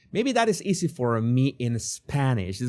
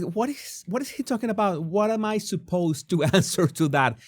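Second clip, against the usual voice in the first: higher-pitched mock bewilderment; voicing a baffled man's thoughts, pitched up; imitating bewilderment; 7 semitones higher than usual. Host imagining what Harry is thinking, mock bewildered. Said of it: "Yeah, he looks like".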